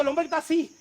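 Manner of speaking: ordinary talk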